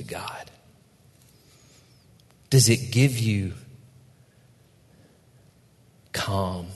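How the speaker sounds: slow, hushed meditation voice; guided meditation. Acted. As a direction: ease them into stillness, unhurried. Closing meditative invitation.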